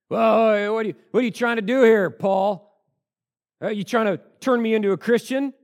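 high, loud mocking sneer; snide remark. Agrippa sneering at Paul, loud and snide. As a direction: scoff at Paul's attempt. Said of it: "it is more of a snide remark".

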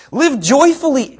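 high, loud mocking lilt; sarcastic reading. Shown as a demonstration. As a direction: read it dripping with sarcasm. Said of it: "Now, hear the sarcasm in this".

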